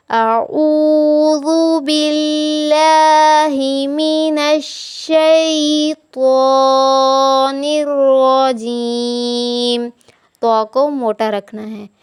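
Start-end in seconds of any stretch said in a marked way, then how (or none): 0.1-9.9 slow, melodic tajweed recitation; recitation to repeat. Shown as a demonstration. Teacher recites so students repeat three times after her. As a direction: recite it slowly for students to echo. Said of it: "मेरे साथ तीन मरतबा दोहराइए।".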